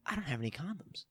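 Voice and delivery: hushed, breathy whispered realization; panicked whisper. Retold or imitated. Realizing mid-hookup he has no condoms, whispering. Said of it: "I'm like".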